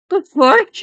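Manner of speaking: high, loud "The fuck?" in shock; startled disbelief. Real, not acted. Telling a friend about a strange voice at work, shocked. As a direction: blurt "The fuck?" in sudden alarm. Reacting to the angel story.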